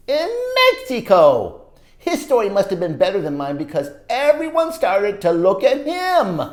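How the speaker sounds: put-on lively read-aloud for kids; storybook narration. A narrator's reading. Reading a story to children, animated narration. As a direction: tell it like a picture book to children. Reads picture book aloud.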